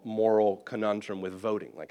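ordinary delivery

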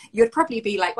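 usual speaking voice

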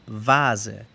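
"vase" with a buzzing v, "vaaze"; slow German "vase", voiced v and z; V inglesa. Shown as a demonstration. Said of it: "como la V en inglés". ("vase" con v vibrante, "vaaze")

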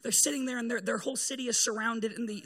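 ordinary talk, nothing marked

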